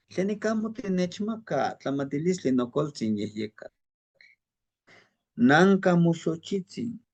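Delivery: slow, hushed prayer recited with reverence. Shown as a demonstration. Teacher reciting a Nahuatl prayer, slow and hushed. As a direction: murmur it slowly like an offering. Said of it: "Es parte de una plegaria".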